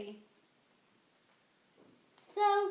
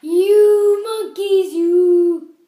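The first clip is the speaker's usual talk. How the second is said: loud, slow scolding yell; angry peddler; 5 semitones higher, 20 dB louder, much slower than usual. Acted. Peddler yells at the cap-stealing monkeys, slow and loud. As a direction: shake a finger and yell at the monkeys. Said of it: "he said, shaking a finger at them".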